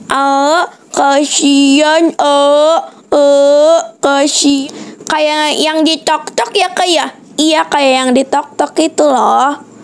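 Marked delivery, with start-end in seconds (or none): 0.1-4.7 imitating beggar. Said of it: "kayak gini".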